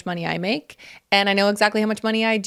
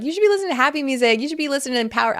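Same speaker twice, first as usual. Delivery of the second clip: higher, fast, sing-song lecturing; mock know-it-all voice, pitched up; mocking preachy advice; 6 semitones higher, faster than usual. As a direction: parody smug advice-givers, quickly. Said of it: "people are like".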